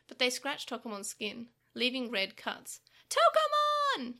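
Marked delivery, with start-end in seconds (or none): 3.1-4.2 high, loud, slow drawn-out scream. Acted. Takeru screaming as Tokomon is hurt, high and loud. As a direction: cry out his friend's name. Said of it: "Takeru screamed".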